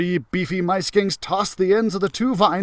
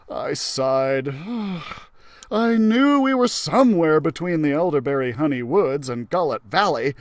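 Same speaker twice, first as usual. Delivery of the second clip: ordinary delivery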